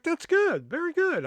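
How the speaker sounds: high, lilting teacher praise imitated; praising teacher. Teacher praises student's answer, imitated high and sing-song. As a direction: play the approving teacher, voice lifted high. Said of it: "And she was like".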